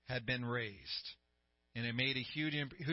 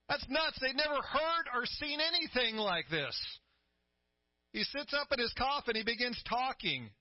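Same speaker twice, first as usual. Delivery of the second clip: full-force preaching; 8 semitones higher than usual. Acted. Pastor preaching the raising of the widow's son, fervent. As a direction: lift the church with building excitement.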